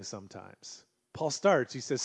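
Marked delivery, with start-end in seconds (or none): none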